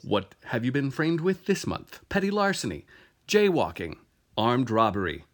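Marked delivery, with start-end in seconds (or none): none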